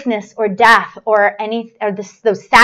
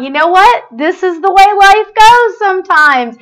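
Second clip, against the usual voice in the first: higher, louder imitation of a lecturer; mock lecture; 7 semitones higher, 5 dB louder than usual. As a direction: imitate someone lecturing you, exasperated. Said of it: "He's giving me a lecture".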